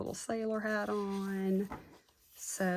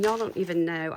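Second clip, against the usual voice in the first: ordinary talk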